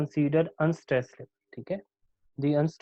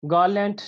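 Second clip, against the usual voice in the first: Garland with a high first syllable; Garland, strong then weak; trochaic stress; 4 semitones higher, 4 dB louder than usual. Shown as a demonstration. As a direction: stress "Gar" and let "land" go weak. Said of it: "first one stress वाला होगा".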